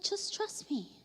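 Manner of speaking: soft, hushed reassurance; quietly calm someone who is worked up; God reassuring. Retold or imitated. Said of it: "and God is like".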